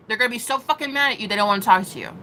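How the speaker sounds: ordinary delivery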